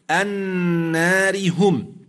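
النار هم slow and joined, with a thin ر; Quranic recitation. Shown as a demonstration. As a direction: recite النار هم without pausing on the ر. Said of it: "قوله تعالى مثلًا".

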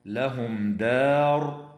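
لهم دار with the dal in دار said light and thin; pronouncing دار with a light dal. Shown as a demonstration. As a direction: keep the dal of دار thin, not heavy. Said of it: "ترقيق الدال في كلمة دار".

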